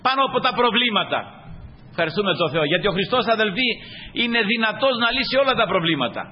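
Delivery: high-pitched, insistent exhortation; emphatic pulpit delivery with lifted voice; impassioned preaching. Real, not acted. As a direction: urge the congregation onward.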